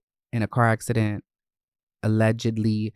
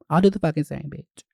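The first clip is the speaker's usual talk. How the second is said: ordinary delivery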